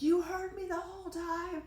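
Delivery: high, strained mock-enraged cry; retold rage. Mother finding her daughter by the lake, acted outrage.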